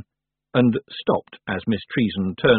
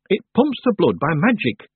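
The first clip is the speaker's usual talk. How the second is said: deliberate, pitched-up explanation; speaking slowly; 8 semitones higher, 3 dB louder than usual. A narrator's reading. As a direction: spell it out as if to someone slow. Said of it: "speaking slowly".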